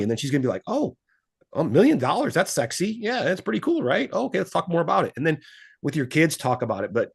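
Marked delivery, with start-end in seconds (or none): 0.7-5.1 high-pitched, pleasantly surprised coming round; intrigued wife. Retold or imitated. Voicing wife hearing of a million dollars, impressed and high-pitched. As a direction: imitate a partner suddenly won over. Voices wife won over by money.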